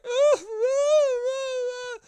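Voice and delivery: high, loud imitated baby wail; mock baby crying. Newborn baby crying for attention, mock wail. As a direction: cry like a baby who wants attention. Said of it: "was crying like".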